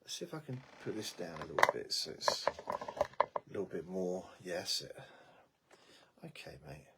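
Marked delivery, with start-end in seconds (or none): none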